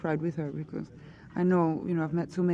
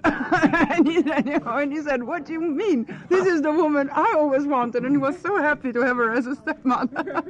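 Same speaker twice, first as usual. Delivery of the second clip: high, loud, delighted telling through laughter; laughing retelling; 8 semitones higher, 7 dB louder than usual. Real, not acted. Actress sharing her half-brother's sweet story, laughing excitedly. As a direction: burst into laughter as you tell it, pitch and volume up.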